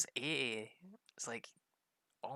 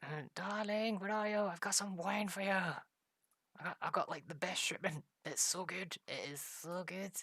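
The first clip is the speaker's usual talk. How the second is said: much higher, lilting call to the wife; sing-song calling darling; 7 semitones higher than usual. Acted. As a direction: coo at her like a proud husband.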